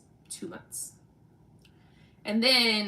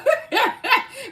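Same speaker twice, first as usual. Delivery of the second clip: burst of laughter; 10 dB louder than usual. Real, not acted. Laughing at own joke.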